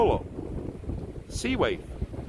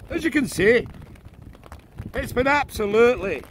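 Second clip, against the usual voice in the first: bad Scottish accent weather forecast; mock Scottish weatherman; 6 semitones higher than usual. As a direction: play a grumpy Scottish weatherman. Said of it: "Over to you, Jamie's Rock McSoul".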